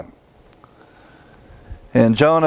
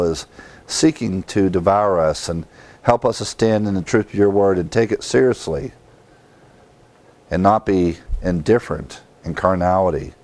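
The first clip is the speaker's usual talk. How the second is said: lower, earnest prayer voice; closing prayer; 4 semitones lower than usual. Acted. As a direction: pray it sincerely, unhurried.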